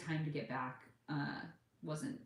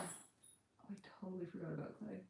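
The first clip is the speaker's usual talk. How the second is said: a much softer, quick, breathy "oh" aside; a sudden whispered aside remembering the dog; hushed realization; 10 dB quieter than usual. Real, not acted. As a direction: drop your voice as it suddenly hits you. Sudden realization mid-story.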